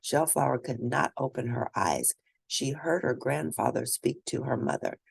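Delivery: plain reading voice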